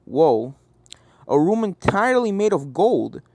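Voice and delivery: ordinary delivery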